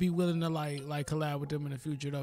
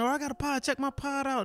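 high-pitched mock eager request; imitating eager podcasters; 9 semitones higher than usual. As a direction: mimic everybody angling for a guest spot, pitched up. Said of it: "you know, everybody".

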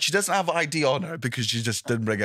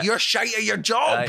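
mock retort; 10 semitones higher than usual. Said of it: "And I'm like".

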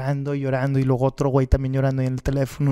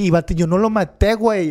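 mock protest; 5 semitones higher, 5 dB louder than usual. Retelling his protest to the accuser.

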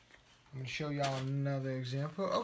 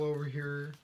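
Texan accent; 3 dB quieter than usual. Real, not acted. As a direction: let the Texas accent color the word. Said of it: "That's my Texas a-, Texan accent".

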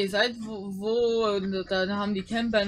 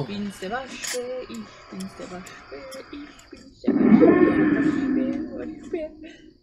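raccoon voice; slower than usual. Pretend. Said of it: "Jetzt bin ich Waschbär".

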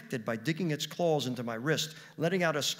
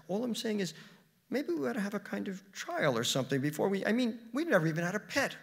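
higher-pitched, defensive backpedalling; placating; 8 semitones higher than usual. Acted. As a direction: plead your case, voice pitched up. Husband backpedals in quarrel.

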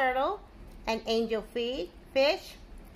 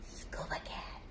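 whisper; 11 dB quieter than usual. Acted. Whispered title reveal.

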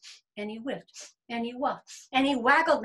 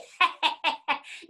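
high, loud cackle; mocking worm laugh; 6 semitones higher, 5 dB louder than usual. Worm challenging the big boys, mocking laugh. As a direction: cackle at the boasters.

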